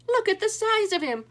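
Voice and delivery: put-on aghast at the giant baby; loud high astonishment; amazed exclaim. A narrator's reading. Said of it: "exclaimed Cuchulainn".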